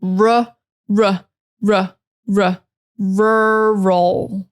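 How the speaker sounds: "r" repeated as ruh, then "rural" drawn out; English R drill. Shown as a demonstration. Teacher drilling the English R, slow and separated. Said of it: "Get that English R".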